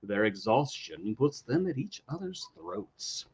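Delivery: hammed-up next-week promo read; dramatic episode preview. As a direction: build to a swooping high reveal, then hush.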